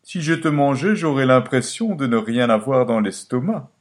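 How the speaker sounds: the fox replies to Tom, high and sly; fox's voice. Acted. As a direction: answer slyly as the fox. The fox keeps talking.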